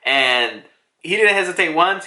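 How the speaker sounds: normal talking voice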